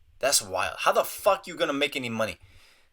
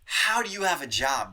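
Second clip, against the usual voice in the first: normal talking voice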